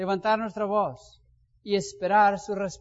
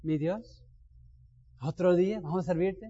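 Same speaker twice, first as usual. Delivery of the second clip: grateful morning greeting. Shown as a demonstration. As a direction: greet the new day gently with thanks. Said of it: "¿Cómo lo dices tú?".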